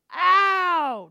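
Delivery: long, high cry of pain; loud yelp. Acted. Preacher acting the fall from mountaintop to valley, loud yelp. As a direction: yell out a drawn-out ow. Acts being knocked down from mountaintop.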